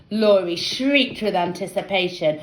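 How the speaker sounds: ordinary delivery, nothing marked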